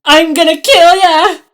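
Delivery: very high loud scream of threat; full shout. A narrator's reading. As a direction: scream it with all you have. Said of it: "Ella shouted".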